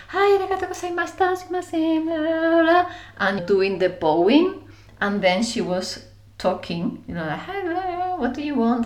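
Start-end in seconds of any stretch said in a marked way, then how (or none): 0.1-2.9 high, fast, sing-song Japanese greeting; bright, rapid bowing greeting; imitating lift attendant. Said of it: "she was like". 7.4-8.9 high, sweet service voice; imitating trolley attendant's nice voice. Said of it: "with a nice voice because you need to talk like this".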